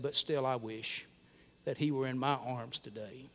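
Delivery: soft, trembling with grief; a quiet, grieving father's plea. Retold or imitated. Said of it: "with a trembling voice".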